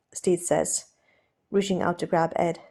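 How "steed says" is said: ordinary delivery, nothing marked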